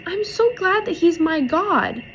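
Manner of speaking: put-on high little-girl voice at normal volume; retold little girl. Little girl speaks of God, imitated high child voice. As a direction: mimic a child's high, simple gladness. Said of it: "she goes".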